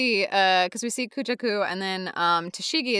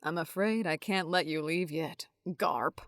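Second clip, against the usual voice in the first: quiet menacing voice; 2 semitones lower, 7 dB quieter than usual. Acted. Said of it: "He says".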